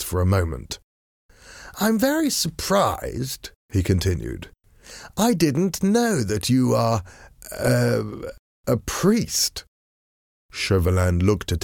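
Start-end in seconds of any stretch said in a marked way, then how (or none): 1.8-3.5 high, slow, mock-concerned fop; lazy aristocrat needling his enemy, amused; foppish gentleman. A narrator's reading. 5.2-9.6 high, slow, mock-concerned fop; lazy aristocrat needling his enemy, amused; foppish gentleman. A narrator's reading.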